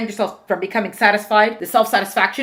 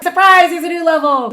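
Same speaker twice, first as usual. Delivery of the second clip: higher, louder surprise announcement; spring the twist on them, raised voice; ta-da reveal, pitched up and loud; playful surprise; 8 semitones higher, 7 dB louder than usual. Pretend. Springs a new twist on listeners.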